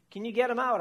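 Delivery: pleading ask. A narrator's reading. Queasy whale begging Doctor Crab, urgent plea. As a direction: beg the doctor to fix it. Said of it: "I asked Doctor Crab".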